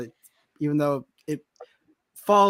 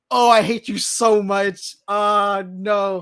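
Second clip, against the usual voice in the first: imitated anguish; 4 semitones higher, 5 dB louder, much slower than usual. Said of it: "the voice crack".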